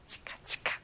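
breathy chika chika brushing sound; whispered brushing noise. Shown as a demonstration. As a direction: whisper it like a toothbrush scrubbing. Teacher mimics brushing sound.

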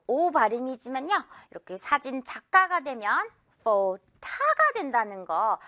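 usual speaking voice

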